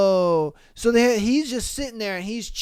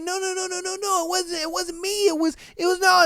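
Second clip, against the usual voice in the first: mock panicked denial; 9 semitones higher, faster than usual. Accused bystander answers the dancer, mock panicked. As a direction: imitate a flustered man pleading innocence. Accused man denying the spill.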